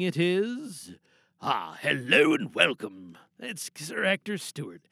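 ordinary talk, nothing marked

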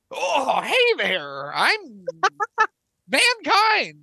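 swooping wrestler impression, pitch leaping up; goofy character voice with big pitch swoops; Mankind. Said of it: "That's how he talked as Mankind".

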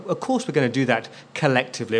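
ordinary talk, nothing marked

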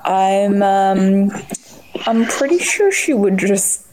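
normal talking voice

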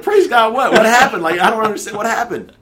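very high, loud exasperated questions; bewildered outburst. Retold or imitated. Confused father questioning his jubilant caseworker, bewildered shout. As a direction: demand an explanation in mock-frantic confusion.

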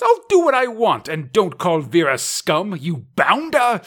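loud, high-pitched posh English toff; posh British accent. Acted. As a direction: play an indignant foppish aristocrat. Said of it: "rich guy British accents".

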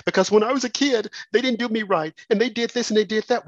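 high-pitched mimicked grievance; mock complaining. Mimics the complaint of church quitters.

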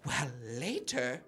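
put-on slow, high old lady plans; grandma voice. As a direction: savour the treat plan. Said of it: "And she'd be like".

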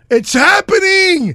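slow, high, furious shout; angry shout. Real, not acted. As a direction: shout it down at them. Heated quarrel, shouting over opponent.

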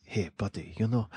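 mock gentle whisper. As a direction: lean in and whisper it. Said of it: "when he's whispering".

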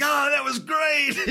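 high, slow, beaming praise; proud father. Retold or imitated. Lawler praising the bloody rookie backstage, high and beaming. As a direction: gush like a proud dad. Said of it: "like a proud father".

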